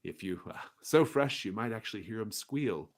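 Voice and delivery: lightly voiced butcher bragging; boastful merchant. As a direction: brag with relish.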